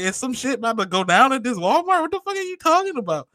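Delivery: very high, hammed-up mock alarm; incredulous. Acting out his panic at Walmart, high incredulous voice. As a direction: squeak out the retold disbelief.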